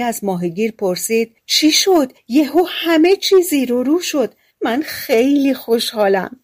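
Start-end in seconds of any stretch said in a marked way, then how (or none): 1.5-6.4 high, excited, bubbling over with joy; wife gushing at the sudden palace; overjoyed wife. Acted. Wife delighted by the palace, high excited voice. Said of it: "خیلی ذوق زده به نظر می آمد و با خوشحالی".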